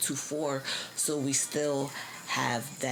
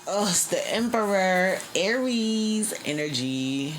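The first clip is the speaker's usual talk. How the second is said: excited reveal; 6 semitones higher, 7 dB louder, slower than usual. Real, not acted. Card reveal.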